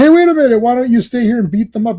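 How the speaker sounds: high, loud mock objection; urgent protest. Voices the Russians pleading with Quinn.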